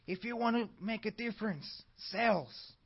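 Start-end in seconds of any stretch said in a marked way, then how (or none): none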